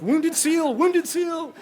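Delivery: high, loud frantic wounded seal cry; panicked shout. Retold or imitated. Thrashing in the water near the shark, yelling in panic. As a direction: scream it in mock panic, thrashing.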